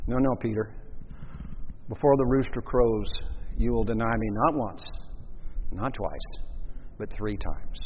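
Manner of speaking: Jesus calmly warning Peter; gentle foretelling. Retold or imitated. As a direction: tell him sadly but kindly.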